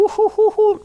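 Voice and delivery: high, playful "ooh-hoo-hoo"; mock hooting. Comic teasing his listeners about a crush, mock high hooting. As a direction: hoot it out high in jest. Joking hoots after risqué remark.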